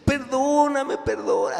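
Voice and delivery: husband begging forgiveness, hammed-up crying; mock crying plea. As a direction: ham up a tearful husband. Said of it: "Así llorando el hombre".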